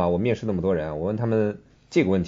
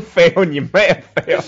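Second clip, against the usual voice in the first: mock retort; 7 semitones higher, 6 dB louder, much slower than usual. Real, not acted. Mocking back the word fail.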